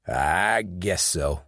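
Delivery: put-on slow, drawn-out Southern accent; a Southern accent, slow and worn out after a beating; gravelly Southern accent. Charlie answering Sean after the beating, in a slow Southern accent. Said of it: "answered in a gravelly Southern accent".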